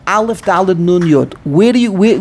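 ordinary delivery

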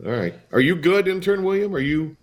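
ordinary talk, nothing marked

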